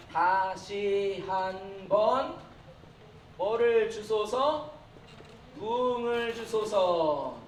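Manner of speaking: very slow, drawn-out syllables; slow recited slogan. Shown as a demonstration. Preacher reads the revival slogan with students, very slow recitation. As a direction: lead the room through it word by word. Leading the congregation in reading slogan.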